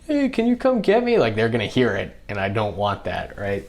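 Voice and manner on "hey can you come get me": much higher, mock helpless call for help; radio plea in a mocking high voice. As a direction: whine it up high like a stranded kid.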